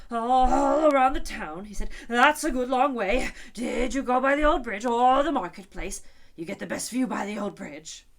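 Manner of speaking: nervous quivering king. A narrator's reading. King nervously interrupting the quarrel, loud and high. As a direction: talk fast to stop a fight. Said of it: "his voice quite quivered".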